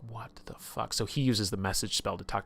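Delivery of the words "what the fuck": breathy whisper of shock; whispered disbelief. Retold or imitated. Hanlon reacts to Aiko being mad at him, whispered disbelief. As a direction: hush it out, stunned.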